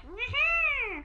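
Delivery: high, sly creepy snicker; creepy giggle. Pretend. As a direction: cackle like a grinning clown. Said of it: "big creepy grin where he's just like".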